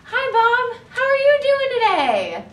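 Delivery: high, loud, bright greeting to a friend; cheery greeting. Acted. Speaker greeting friend Bob, high and loud.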